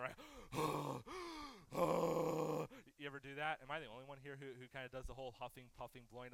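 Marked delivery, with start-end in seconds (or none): 0.2-2.9 mock gasp. Mock outrage over the laundry.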